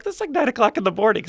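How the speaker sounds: high, loud incredulous reply; scandalised retold exclamation; retold disbelief. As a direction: react in comic shock at the patient. Retold shocked reply to drinking.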